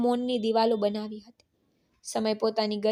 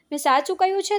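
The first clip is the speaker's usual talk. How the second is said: higher and louder, pleading denial; desperate protest; 8 semitones higher, 5 dB louder, faster than usual. Acted. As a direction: insist hard that you told the truth. Son insists to father he's truthful. (ઊંચા, જોરથી, આજીજીભર્યો ઇનકાર)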